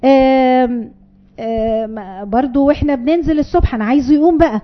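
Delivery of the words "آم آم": normal talking voice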